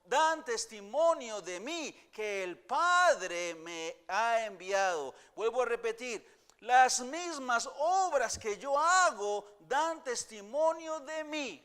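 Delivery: loud, slow, high pulpit reading; full-voice sermon reading; forceful preaching. Acted. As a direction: proclaim the verse to the congregation. Preaching a Bible verse at full force.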